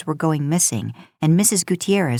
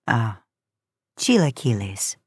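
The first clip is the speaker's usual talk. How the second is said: a hint of older woman; 8 semitones lower than usual. Mrs Gutierrez names the dish.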